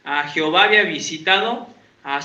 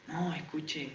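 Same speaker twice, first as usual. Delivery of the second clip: quiet hushed warning from the mother; advertencia en voz baja; 4 semitones lower, 18 dB quieter than usual. Retold or imitated. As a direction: whisper the warning low and urgent. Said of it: "me dice así". (advertencia susurrada de la madre)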